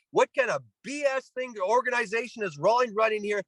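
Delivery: high-pitched mock yell, ranting at the glove company; retold screaming rant. Manager ranting at the Rawlings truck, high mock yell. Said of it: "he's literally screaming on the top of his lungs".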